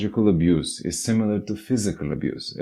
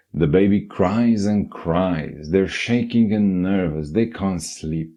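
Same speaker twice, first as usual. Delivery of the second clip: usual speaking voice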